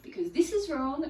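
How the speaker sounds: mock-righteous anger; indignant anger. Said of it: "where we go".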